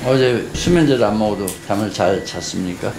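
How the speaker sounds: ordinary delivery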